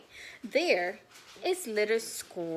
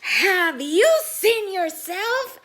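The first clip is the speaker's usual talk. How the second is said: loud high sneering voice, slow; loud, high mocking shape, slowly; mean Little Square; 9 semitones higher, 13 dB louder, slower than usual. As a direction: jeer at the newcomer. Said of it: "asks Little Square".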